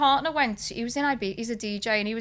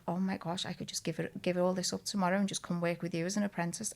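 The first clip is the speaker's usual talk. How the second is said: much softer, wistful; dreamy aside; 5 semitones lower, 12 dB quieter than usual. Retold or imitated. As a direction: muse to yourself softly. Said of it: "And I was like".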